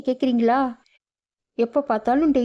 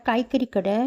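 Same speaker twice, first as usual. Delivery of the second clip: usual speaking voice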